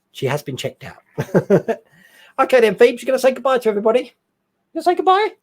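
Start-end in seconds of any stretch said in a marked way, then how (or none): 2.4-5.3 higher, fast coaxing voice to the dog, rising on goodbye; sing-song pet talk. Real, not acted. Streamer coaxing his dog Phoebe to say goodbye, high sing-song pet talk.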